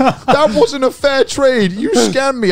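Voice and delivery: high-pitched, drawn-out whine of complaint; mock crying. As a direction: imitate a kid wailing down the phone. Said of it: "he's, like, crying on the phone".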